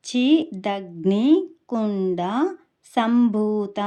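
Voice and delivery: చిదగ్నికుండ సంభూత very slow and loud, broken syllable by syllable; pronunciation demo. Shown as a demonstration. Teacher shows learners how to say the name, slow, emphatic syllables. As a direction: spell out చిదగ్నికుండ సంభూత by ear, each part held long. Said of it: "ఇప్పుడు ఎలా పలకాలి?".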